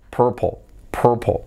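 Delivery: purple said slowly the native way, /popo/, with swallowed vowels. Shown as a demonstration. Teacher answers how natives say purple. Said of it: "¿cómo se dice?".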